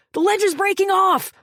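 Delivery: high, loud panicked warning; frantic shout. A narrator's reading. Maddock warning Fleck that the ledge breaks. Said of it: "Maddock hollered".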